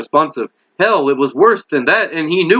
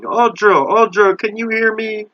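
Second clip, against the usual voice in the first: high, pleading calls to his wife; a frightened husband calling his wife; desperate call; 6 semitones higher than usual. Acted. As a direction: call her name, begging her to answer. Bill calls to catatonic wife.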